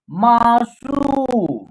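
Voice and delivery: मासूम with a very long सू; long vowel. Shown as a demonstration. Teacher putting the word together, मासूम drawn out slowly. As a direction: stretch out the ऊ in मासूम. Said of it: "उच्चारण long तरीके से करेंगे".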